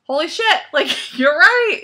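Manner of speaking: high, loud burst of surprise; amazed realization. Retold or imitated. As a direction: blurt it out, floored. Retold realization, excited exclamation.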